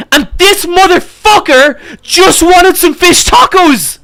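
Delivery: the storyteller telling chat the driver only wanted tacos, outraged yell; exasperated outrage. Real, not acted. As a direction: let the disbelief explode, drawn out. Outraged punchline about the driver.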